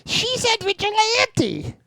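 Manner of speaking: put-on high, slow, cracking teen voice. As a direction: let the voice crack like puberty. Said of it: "his voice cracks every time he talks".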